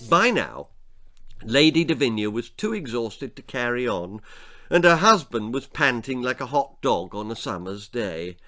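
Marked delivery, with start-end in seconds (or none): none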